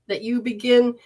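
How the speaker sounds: normal talking voice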